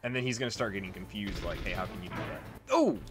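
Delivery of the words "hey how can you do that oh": normal talking voice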